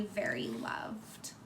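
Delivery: slow, hushed, tender; tender close. Acted. Ending the story for the children, slow hushed tenderness. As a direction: whisper the loving end gently.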